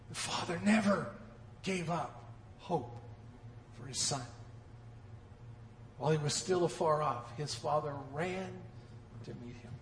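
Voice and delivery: soft, low tender telling; hushed, tender storytelling. Acted. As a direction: draw them in quietly. Tender quiet preaching passage.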